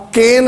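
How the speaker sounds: کین like English Cain, kayn; کین, one syllable as in English; English pronunciation. Shown as a demonstration. Teacher gives viewers the English کین, like Cain. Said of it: "English کا اس کا کین ہے".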